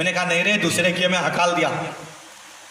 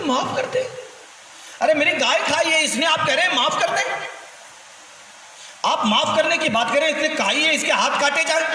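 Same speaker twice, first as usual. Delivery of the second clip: outraged protest; 6 semitones higher than usual. Retold or imitated. Man protests Ali's pardon.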